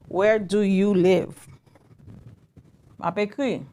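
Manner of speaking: normal talking voice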